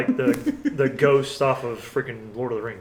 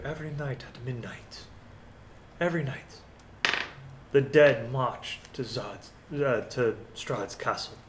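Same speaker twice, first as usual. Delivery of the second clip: soft, hushed telling of an omen; lightly voiced villager, quiet and grave; Irene's voice; 7 dB quieter than usual. Game master voices Irene's answer.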